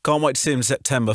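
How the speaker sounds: ordinary talk, nothing marked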